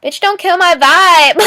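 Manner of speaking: mock irritated retort; mock annoyed outburst. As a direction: snap at the negative lady, half joking. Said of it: "negative energy that was just like".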